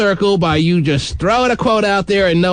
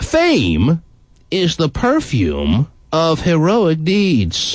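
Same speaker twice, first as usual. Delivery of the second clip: slow grand recital; much slower than usual. Acted.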